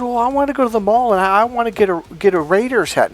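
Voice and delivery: meek husband. Retold or imitated. Husband pleading, voiced high.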